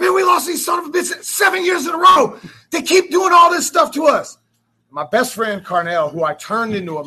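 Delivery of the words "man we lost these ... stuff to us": high, loud, wailing complaint; re-enacted high-pitched yell; retold exasperated outburst. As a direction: act out shouting in despair at your wife. Retells his outburst at his wife.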